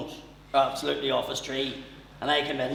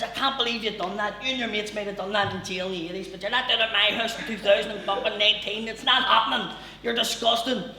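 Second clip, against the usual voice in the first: higher, louder mock rant; imitated furious mother; 7 semitones higher, 3 dB louder than usual. Mother scolding dad for shitting the bed, mock rant. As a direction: tear into him like a tiny pit bull.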